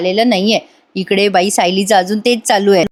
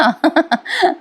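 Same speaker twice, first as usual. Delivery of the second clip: amused chuckle; 7 semitones higher than usual. Real, not acted. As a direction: laugh lightly at your own remark. Narrator chuckles at own remark.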